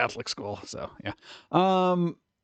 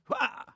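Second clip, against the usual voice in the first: mock startled yelp; 7 dB quieter than usual. Startled by spider bumps.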